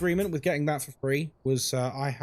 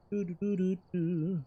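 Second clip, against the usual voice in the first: soft sung doo-doo filler; idle doo-doo humming; 2 semitones higher, 5 dB quieter than usual. Real, not acted. Streamer filling time while waiting, idly doo-dooing. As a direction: hum little doos to yourself.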